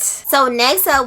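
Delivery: normal talking voice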